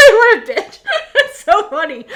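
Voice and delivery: can't stop laughing at the tease; real giggling fit. Real, not acted. Cracking up at the scene.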